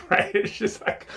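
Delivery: ordinary talk, nothing marked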